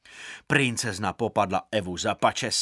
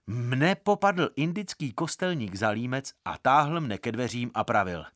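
ordinary delivery

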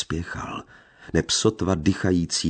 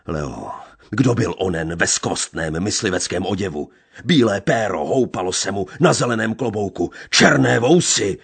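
building to a much louder, higher shout; trembling fury; 4 semitones higher, 6 dB louder than usual. A narrator's reading. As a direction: hurl the accusation at her. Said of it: "na celém těle se třesa dutým volal hlasem".

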